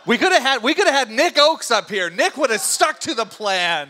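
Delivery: loud, high-pitched joking complaint; mock exasperation. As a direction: protest loudly over the laughter, playfully put out. Host jokingly complains about guest.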